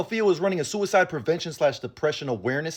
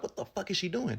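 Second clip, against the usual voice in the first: much softer, near-whispered what the fuck; hushed disbelief; 11 dB quieter than usual. Retold or imitated. Vlogger recalling his confusion at the greenhouse, hushed. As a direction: mutter it under your breath. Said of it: "for a second I was like".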